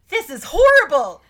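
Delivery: loud fake horror; hammed-up dismayed yell; mock horrified shout. Mock reaction to cursed image.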